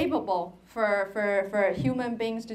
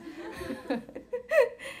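laugh; 10 semitones higher, 5 dB quieter than usual. Real, not acted. Laughing after French kiss joke.

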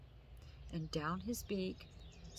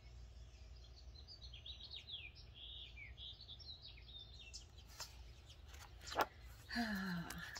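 long soft contented sigh; 3 semitones higher, 10 dB quieter than usual. Real, not acted. Reader finishing the book, long sigh. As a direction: exhale slowly and fully. Reader sighs after story.

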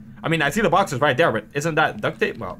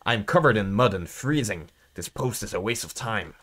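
grumbling watchman; 5 semitones lower, 5 dB quieter than usual. Acted. Voices a grumbling game character.

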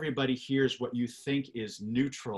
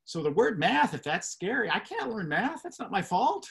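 scared student; 7 semitones higher, 3 dB louder than usual. Retold or imitated. Voicing a scared math student.

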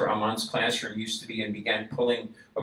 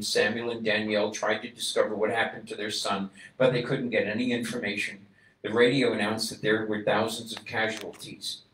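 ordinary talk

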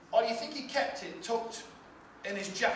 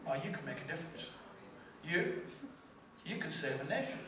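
soft, tender assurance; quietly reassuring God; gentle voice of God; 4 semitones lower, 9 dB quieter than usual. Acted. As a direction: speak it softly, like a loving call. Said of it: "he's saying".

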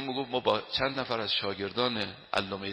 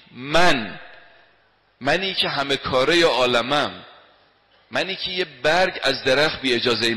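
booming emphasis; 3 semitones higher, 8 dB louder than usual. Acted. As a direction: thunder out each word. Preacher booming God's words.

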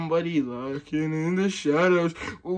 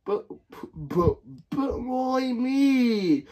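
slow, high, drawn-out whine; whiny protest; 6 semitones higher, much slower than usual. Acted. The friend sent for food, whining slowly. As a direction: drag it out like a sulking kid.

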